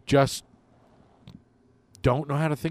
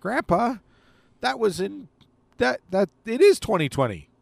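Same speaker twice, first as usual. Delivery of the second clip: put-on little kid voice; 9 semitones higher, 4 dB louder than usual. Grandkid answering grandpa story.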